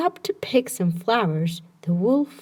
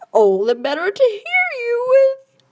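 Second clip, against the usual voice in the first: higher, louder squeaky wolf voice; 13 semitones higher, 5 dB louder than usual. Acted. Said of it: "squeaked the wolf".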